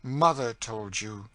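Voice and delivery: "Mother" drawn out loud with bitter emphasis; thrilling, scornful weight on "Mother"; bitter stress on MOTHER. A narrator's reading. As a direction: lean slowly and bitterly on "Mother". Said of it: "thrilling and bitter emphasis on the word mother".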